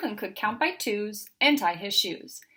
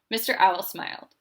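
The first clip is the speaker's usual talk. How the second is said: ordinary delivery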